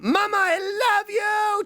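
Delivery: shrill cartoon squeal; high squealed shout. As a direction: squeal it at the top.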